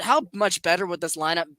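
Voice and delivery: normal talking voice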